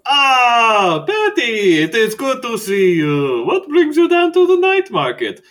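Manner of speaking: high, loud, theatrical vampire greeting; Skurlock the vampire. Acted.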